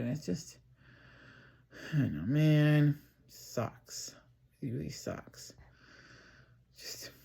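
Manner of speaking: drowsy, fading into a near-whisper; half asleep, words trailing off; falling asleep. Real, not acted. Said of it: "Also, I'm falling asleep".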